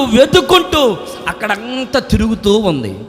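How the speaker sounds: ordinary talk, nothing marked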